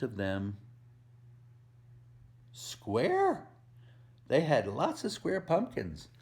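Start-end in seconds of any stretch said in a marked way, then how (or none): 2.5-3.5 put-on spring it on the kids like a surprise; surprised reveal. Reader revealing square pumpkins to children, high surprised squeal. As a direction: squeal the word high with delight. Reveal word in read-aloud.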